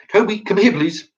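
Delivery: high, pleading call to a dog; mock sweet dog call. Mocking owners who politely beg their dog, high sweet call.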